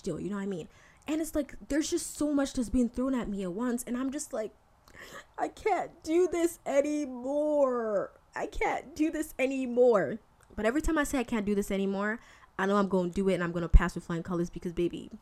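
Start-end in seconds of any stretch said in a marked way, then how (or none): 5.4-10.2 high, dragged-out whine of defeat; hammed-up despairing protest; mock overwhelmed wail. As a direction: wail it slowly, stretching each word. Acts out being overwhelmed by it all.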